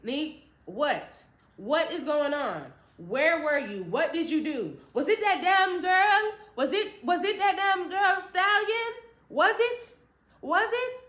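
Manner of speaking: high loud accusing mother; imitating an accusing mother; accusing mom. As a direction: hammer the questions, suspicious.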